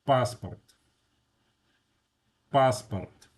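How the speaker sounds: "passport" said the Russian way, PAHS-part; "passport" as Russian "PAHS-purt", tapped r; Russian pronunciation. Shown as a demonstration. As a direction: stress the first A of "passport", unstressed O as a short A. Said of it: "it's pronounced A".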